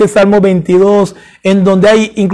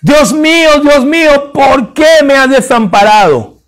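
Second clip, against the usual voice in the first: desperate cry; 5 semitones higher than usual. Acted. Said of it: "Lo grita en arameo".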